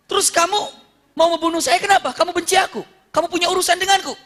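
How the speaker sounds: high, loud challenge; heated questioning. Acted. Muhammad questions his would-be killer, acted heated questioning.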